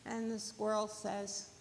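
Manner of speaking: usual speaking voice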